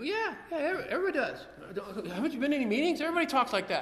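high, cocky brush-off of his younger self; mock dismissive.

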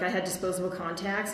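ordinary talk, nothing marked

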